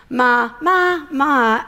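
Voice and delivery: "Ma, ma, ma" said on different Thai tones. Shown as a demonstration. Preacher showing the crowd Thai tones. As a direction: sing each "ma" on its own Thai tone. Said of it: "That's five words".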